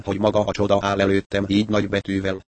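plain reading voice

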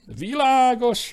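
light up with sudden relief; brightening up. A narrator's reading. Claude cheered by the plan, bright and high. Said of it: "mondta Claude felderülve".

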